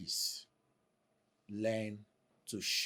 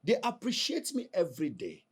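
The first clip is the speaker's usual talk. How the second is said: boastful; 9 semitones higher, 7 dB louder than usual. Acted. Husband boasting to wife.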